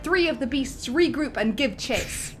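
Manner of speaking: usual speaking voice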